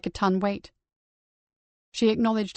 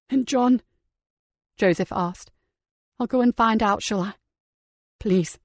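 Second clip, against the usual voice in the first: light woman's voice, reassuring; a caring woman calming him; female character voice; 3 semitones higher than usual. Acted. Nikki's voice held.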